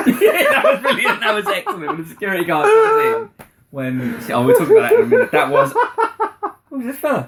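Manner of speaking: high, laughing through the words; giggling while recalling the clip; can't stop laughing while praising the scene. Real, not acted. Laughing at the memory.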